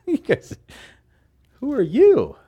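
high, loud mock disbelief; incredulous challenge. Preacher challenging the scholars who voted on Jesus' words, incredulous. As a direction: challenge them in a high-pitched, laughing outburst.

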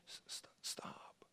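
soft breathy stop; whispered stop. Shown as a demonstration. Preacher telling people to stop unloading, whispering. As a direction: hush them with a whisper.

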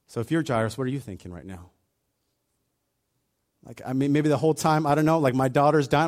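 ordinary delivery

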